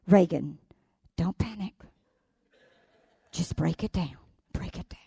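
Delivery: low and soft self-coaching; imitating quiet self-talk. The girl calming herself over math, softly. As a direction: murmur it to yourself. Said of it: "I heard her go".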